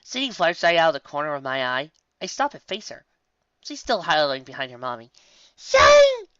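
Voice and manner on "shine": very high, loud call; excited yell. A narrator's reading. Young pony calling her shy friend, excited yell. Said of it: "I yell, bouncing around".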